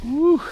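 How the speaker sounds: high-pitched "woo" of delight; excited whoop. Real, not acted. Angler celebrating a landed fish, excited whoop. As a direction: let out a high, happy whoop.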